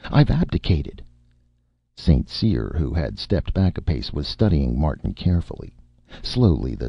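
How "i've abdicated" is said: high, feeble announcement; concealed panic. A narrator's reading. Martin caught and concealing panic, feebly. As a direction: hide the panic poorly. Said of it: "desperate attempt to conceal his stress-triggered panic".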